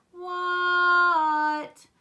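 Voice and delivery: high, loud, very long word; hollered reply. A narrator's reading. Froggy answering Dad's call, long high holler. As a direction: shout back, holding it out.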